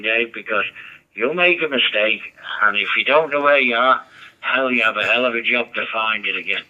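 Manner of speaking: normal talking voice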